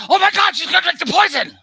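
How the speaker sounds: high, loud panicked cry; loud, high-pitched gasp of alarm at the stage; alarmed shout. Retold or imitated. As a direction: shriek it like a theatergoer who can't believe it. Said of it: "the woman sitting in front of me in the theater went".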